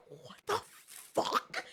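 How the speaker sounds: high, strained, drawn-out disbelief; stretched, high-pitched incredulity; retold outrage. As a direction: squeeze out a mock-outraged reaction, very slowly. Retold outrage at being told calm.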